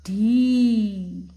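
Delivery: ढी loud, with a long ee; long-vowel demonstration. Shown as a demonstration. Teacher joins letter and matra, long loud ढी. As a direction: hold ढी out loudly. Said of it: "इसका उच्चारण है ढ".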